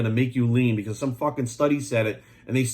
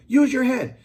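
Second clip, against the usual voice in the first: exasperated shout; 10 semitones higher, 5 dB louder, slower than usual. Real, not acted. Berating viewers.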